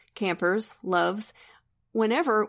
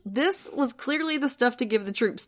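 usual speaking voice

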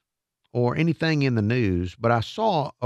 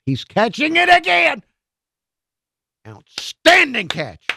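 mock play-by-play, high and loud, slowing on the praise; excited play call; 13 semitones higher, 7 dB louder, slower than usual. Host reliving the catch for listeners, shouting it excitedly. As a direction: call the catch like it's happening live.

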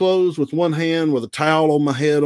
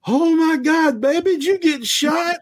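put-on much higher, shocked old lady voice; alarmed lady. Store lady gasping at a bloody wrestler, much higher and alarmed. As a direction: squeal it like a horrified stranger. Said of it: "She goes".

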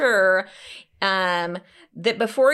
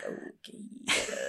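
whispered okay; 13 dB quieter than usual. Retold or imitated. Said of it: "And I'm like".

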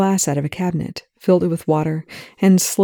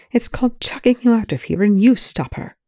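higher, emphatic comeback; exasperated retort; 6 semitones higher than usual. A narrator's reading. Rush telling Young to stop her himself, exasperated. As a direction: throw it back with impatient emphasis.

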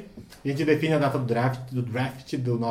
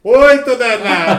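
excited shout; 7 semitones higher, 13 dB louder, much slower than usual. Real, not acted. Number drawn in the pizza raffle.

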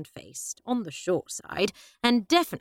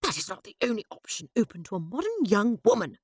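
snapped; 2 semitones higher, faster than usual. A narrator's reading. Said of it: "she snapped".

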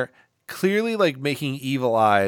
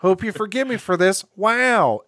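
higher, mock-dramatic actor impression; Owen Wilson; 6 semitones higher than usual. Turncoat aiming a gun at his girlfriend, hammed-up impression. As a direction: swoop up on a catchphrase wow. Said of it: "is like".

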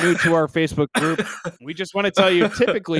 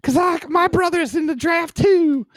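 much higher, silly put-on voice; Graham Clark; 11 semitones higher than usual. Player begging to lose for his brother's draft, silly high voice. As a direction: pipe up like a pleading player. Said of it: "Graham Clark comes in and is like".

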